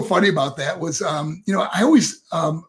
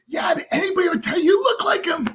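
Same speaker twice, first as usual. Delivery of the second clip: much higher, shouted question; excited shout; 12 semitones higher, faster than usual. Retold or imitated. Dusty Rhodes pressing the kid about the resemblance, shouted. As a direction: yell it with delight at the kid. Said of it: "He goes".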